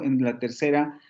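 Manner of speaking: usual speaking voice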